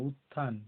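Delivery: উত্থান with ত held into an aspirated থ; both ত and থ sounded. Shown as a demonstration. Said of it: "তয়ের উচ্চারণ হচ্ছে".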